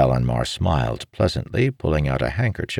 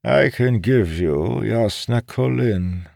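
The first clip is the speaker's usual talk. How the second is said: higher, slow, idly dangled offer; unhurried, higher-pitched old schemer; idle offer; 8 semitones higher than usual. A narrator's reading. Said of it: "Balanmar said idly".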